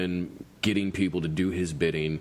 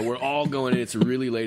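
ordinary talk, nothing marked

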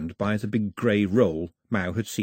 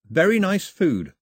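put-on slow, careful, higher boy voice forcing a compliment; polite boy; 9 semitones higher, 5 dB louder than usual. A narrator's reading. Boy praising the girl's bad bread, slow and careful. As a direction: say it bravely over awful food.

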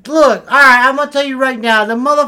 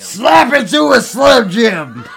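slow, loud wrestler-style shout of "Slap it to a Slim Jim"; 6 dB louder, slower than usual. Shown as a demonstration. Guest asked to say the Slim Jim slogan, slow bellowed promo. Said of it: "Can you do me a favor and say".

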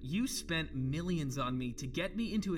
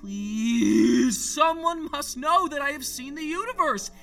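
desperate pleading; 8 semitones higher, 6 dB louder, slower than usual. Acted. Desperate plea to be heard.

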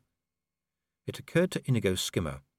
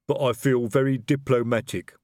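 a troll fumbling for words in his suit; 4 semitones higher, 4 dB louder than usual. A narrator's reading.

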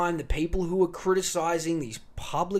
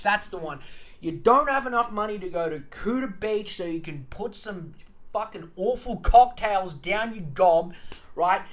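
usual speaking voice

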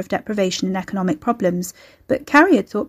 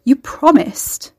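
drawn-out, higher, breaking reproach; 6 semitones higher, 5 dB louder, much slower than usual. A narrator's reading. Carrie reproaches Phil for calling her, voice breaking. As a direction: let the voice crack, slow and louder. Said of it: "Her voice broke".

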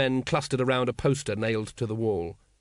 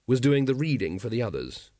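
plain reading voice

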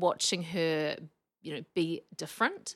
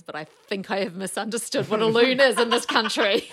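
louder, laughing realisation; laughing disbelief; 5 semitones higher, 11 dB louder than usual. Real, not acted. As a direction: laugh through the confession. Realising mistake, laughing retelling.

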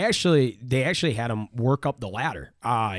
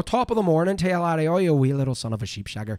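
higher, hammed-up stage Irish brogue; 5 semitones higher than usual. Shown as a demonstration. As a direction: ham up a cheeky Irish lilt. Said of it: "what a brogue is".

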